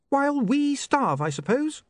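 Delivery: put-on high, sharp young woman's voice; an offended, prim young lady; indignant young woman. Sacharissa accuses.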